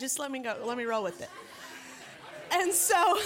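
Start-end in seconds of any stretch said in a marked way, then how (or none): 2.5-3.2 high, loud put-on sob voice; badly faked tearful wail; mock weeping. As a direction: imitate crying, pitched up. Resumes weeping husband impression.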